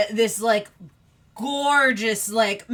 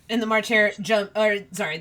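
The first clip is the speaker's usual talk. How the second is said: ordinary delivery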